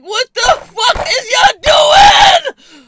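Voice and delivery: stretched-out exasperated scream; furious shout. Retold or imitated. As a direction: bellow it at the friends. Outraged friends went back.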